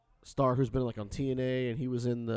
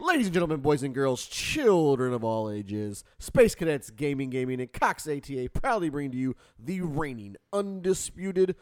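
booming ringmaster announcement; big showman intro, stretched out; 7 dB louder, slower than usual. Acted. Podcast intro announcer hype.

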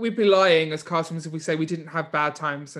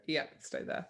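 softer, whispered verdict; whispered aside; 13 dB quieter than usual. Retold or imitated. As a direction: settle it under your breath. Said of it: "I'm like".

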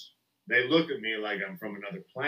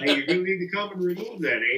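high-pitched request in character; put-on local complainer; 8 semitones higher than usual. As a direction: make a polite local complaint, eh-style. Acted local complainer with tag eh.

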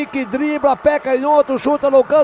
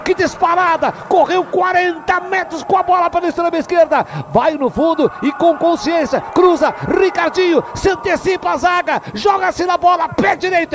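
higher, loud goal scream; explosive goal commentary; 6 semitones higher, 4 dB louder than usual. Real, not acted. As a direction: scream the goal, then rave. Narrator calls equalizer.

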